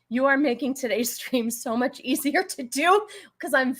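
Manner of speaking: usual speaking voice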